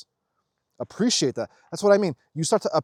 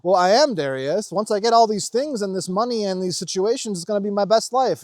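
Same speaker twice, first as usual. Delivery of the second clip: higher, louder mock objection; defensive protest; 6 semitones higher, 5 dB louder than usual. Imagined follower argues back to Darius, mock-defensive. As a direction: imitate someone insisting they have it figured out.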